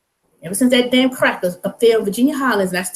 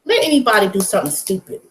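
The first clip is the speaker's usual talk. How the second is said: usual speaking voice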